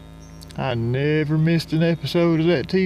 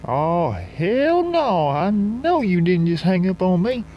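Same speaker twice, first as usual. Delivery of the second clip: mock screaming; 4 semitones higher than usual. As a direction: imitate an outraged caller without really screaming. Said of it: "She was a screaming".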